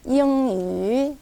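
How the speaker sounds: 이응이 stretched out, nasal ng; 이응이 drawn out syllable by syllable; 이응이 천천히 비음으로; slow nasal ieung. Shown as a demonstration. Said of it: "이응이 이렇게 하셔야 돼요".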